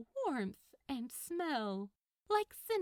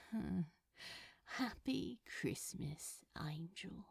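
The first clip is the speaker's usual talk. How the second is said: heartfelt whisper; 4 semitones lower, 6 dB quieter than usual. Acted. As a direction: say it softly and mean it. Crowley's heartfelt whispered reply.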